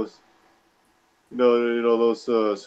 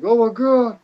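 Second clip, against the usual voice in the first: high, loud panicked cry; mock burning-mouth panic; freaking out; 13 semitones higher, 6 dB louder than usual. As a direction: yelp it like your mouth is on fire. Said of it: "he's just freaking out".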